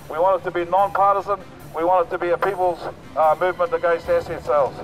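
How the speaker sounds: ordinary talk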